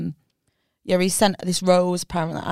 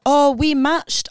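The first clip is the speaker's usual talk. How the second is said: drawn-out slow quote; mimicking a guy; 6 semitones higher, 3 dB louder, much slower than usual. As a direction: stretch it out, mock sincere. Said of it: "He was like".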